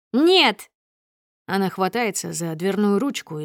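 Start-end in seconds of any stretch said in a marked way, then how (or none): 0.1-0.7 loud, high-pitched cry of refusal; angry refusal. A narrator's reading. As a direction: refuse him with a sudden yell. Wife refuses to leave the car. (резкий гневный выкрик)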